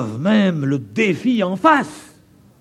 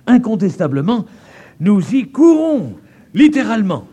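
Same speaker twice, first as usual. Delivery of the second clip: preaching build; 6 semitones higher, 7 dB louder than usual. Acted. Preacher rising to warning.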